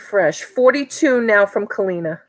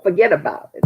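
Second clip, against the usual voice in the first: put-on lightly done Brooklyn accent, a touch lower; 4 semitones lower, faster than usual. Host showing viewers what a Brooklyn accent is, mock Brooklyn accent. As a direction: toss it off like a New York wise guy in Brooklyn accent. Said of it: "That's, that's the Brooklyn".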